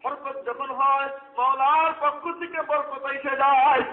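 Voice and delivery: loud, high, slowed preacher's cry; preaching at full force. Acted. Preacher calling out to the gathering in a loud, high cry. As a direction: lift the crowd with a slow, loud call.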